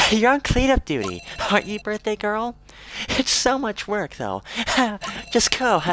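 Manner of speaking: high, playful schoolgirl voice; teasing girl voice. Acted. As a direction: tease her like a giggly classmate.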